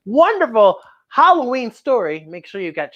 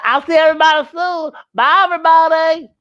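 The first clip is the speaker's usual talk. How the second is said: higher, louder cheerful farewell call; puppet shouting goodbye; 8 semitones higher, 5 dB louder than usual. Pretend. Puppet Bookie calling goodbye to the kids, loud and high. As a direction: call out bye like an eager puppet.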